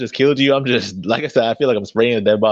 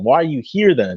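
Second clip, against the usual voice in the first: ordinary delivery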